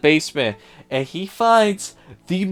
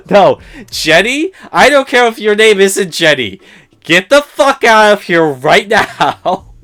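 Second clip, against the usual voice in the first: louder, fed-up yelling at the screen; exasperated rant; 4 semitones higher, 8 dB louder than usual. Real, not acted. Reviewer yells at Jenny to leave, exasperated. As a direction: really let the frustration out. Ranting at film character.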